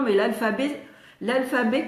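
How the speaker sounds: ordinary delivery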